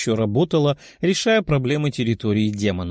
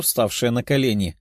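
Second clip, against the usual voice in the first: ordinary delivery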